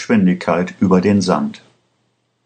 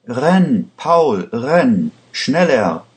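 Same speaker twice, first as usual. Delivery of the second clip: high, pressing cry to run; urgent plea; 6 semitones higher, much slower than usual. A narrator's reading. Lisa begging Paul to run faster, urgent and high. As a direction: urge him on, breathless and high. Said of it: "bat Lisa".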